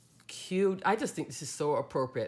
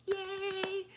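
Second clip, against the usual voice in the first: excited cheer; 6 dB quieter than usual. Real, not acted. Cheering the Mother's Day project.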